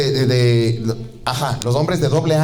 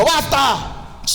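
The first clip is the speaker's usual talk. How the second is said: commanding; 10 semitones higher than usual. Acted. Jesus commands the storm.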